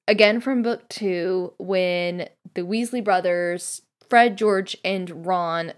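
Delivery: ordinary talk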